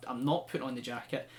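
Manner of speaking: ordinary talk, nothing marked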